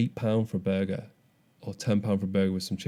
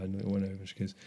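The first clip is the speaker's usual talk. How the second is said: usual speaking voice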